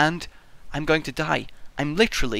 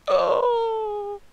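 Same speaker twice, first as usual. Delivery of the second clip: mock dying cry; 3 dB quieter than usual. Acting out last words.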